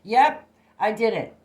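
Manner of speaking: loud, high, eager messenger; messenger reporting back. Retold or imitated. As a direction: shout it like a proud servant back from the job. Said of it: "he comes back and says".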